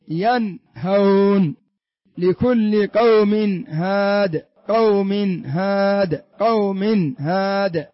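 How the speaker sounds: slow, drawn-out "قوم هاد", tanween n clearly sounded before ه; measured demo, clear ن in "ينهون" and "قوم هاد"; إظهار النون. Shown as a demonstration. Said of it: "أنها تظهر". (نطق "ينهون" و"قوم هاد" ببطء مع إظهار النون)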